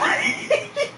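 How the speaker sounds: high, wheezy giggle; laughing. Real, not acted. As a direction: let the last laughs squeak out. Still laughing at own slip.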